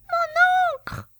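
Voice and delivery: very high, squeaky mouse-like cry; tiny pen voice. Acted. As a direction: squeak it like a panicked little pen. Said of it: "une petite voix à peine audible".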